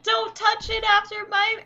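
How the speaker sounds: put-on retelling the kid's line with a laugh; kid voice again. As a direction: whine it like a little kid.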